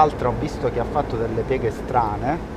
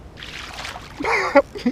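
high breathless laugh. Real, not acted. Reacts to the octopus.

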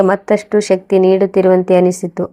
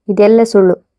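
plain reading voice

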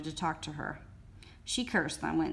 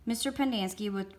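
usual speaking voice

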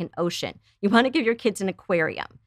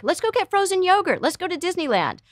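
much higher, bright mock-cheerful offer; mock cheery parent; 11 semitones higher than usual. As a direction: play the parent rushing to cheer the kid up. Mimicking an over-cheerful parent.

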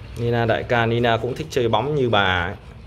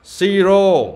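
calling out; 8 semitones higher, 6 dB louder than usual. Real, not acted. Calling dog by name.

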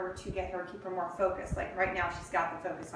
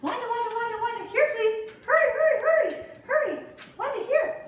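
very high, loud, urgent hurry; urgent high calling; 7 dB louder than usual. Real, not acted. Urging puppy to come.

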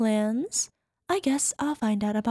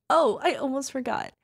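ordinary talk, nothing marked

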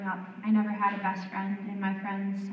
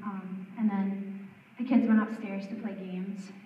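ordinary talk, nothing marked

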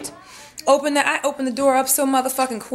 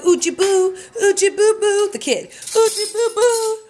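high, slow sing-song cooing, louder; mocking baby talk; 12 semitones higher, 4 dB louder, slower than usual. Imitating the boy cooing at the dog, high sing-song. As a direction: mimic a kid cooing at a puppy. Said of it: "he was all like".

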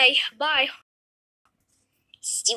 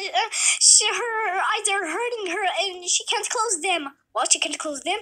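ordinary talk, nothing marked

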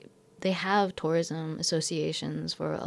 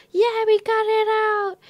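much higher, louder, slow victory cry; triumphant cheer; 7 dB louder, slower than usual. Retold or imitated. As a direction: crow it out, stretched and high. Triumph after pulling the cat out.

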